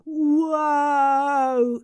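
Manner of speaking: high, drawn-out whoa; long whoa. Shown as a demonstration. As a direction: release one long, lifted whoa. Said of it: "Give me some whoa".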